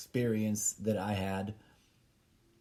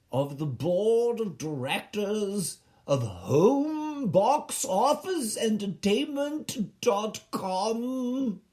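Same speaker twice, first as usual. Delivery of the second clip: higher, slow pompous address fading out; grand letter voice; 8 semitones higher, slower than usual. Pretend. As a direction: address the noble board with mock grandeur. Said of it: "I was writing a letter".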